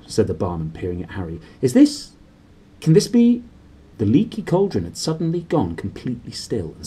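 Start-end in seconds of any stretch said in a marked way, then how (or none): 1.6-3.4 high, astonished gasp of disbelief; amazed. Acted. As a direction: squeak it out in wonder. Barman amazed, voiced.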